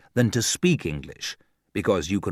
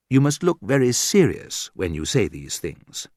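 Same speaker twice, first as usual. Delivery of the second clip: usual speaking voice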